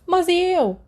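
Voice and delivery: agudo e alto, ofendido de brincadeira; arquejo dramático. Acted. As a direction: fingindo choque teatral. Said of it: "ofegando dramaticamente". (James finge ofensa ao Sirius, arquejando)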